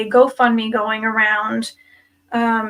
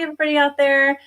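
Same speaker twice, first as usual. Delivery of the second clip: ordinary delivery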